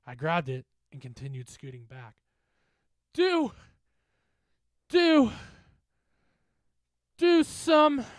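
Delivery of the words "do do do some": high, loud broken cries; failing breath, desperate. Acted. Dying bully begging friends to act, gasped cries. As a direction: force out each word, then break off. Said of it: "his breath becoming more and more shallow".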